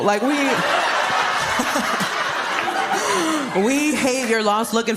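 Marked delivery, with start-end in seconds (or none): 0.3-3.6 real burst of high laughter; big laugh. Real, not acted. Comic laughing at her own joke. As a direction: break up laughing mid-sentence.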